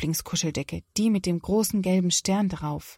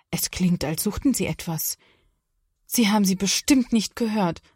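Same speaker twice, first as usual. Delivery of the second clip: ordinary delivery, nothing marked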